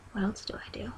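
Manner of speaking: whispered self-mocking aside; whispering. Real, not acted. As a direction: drop to a whisper.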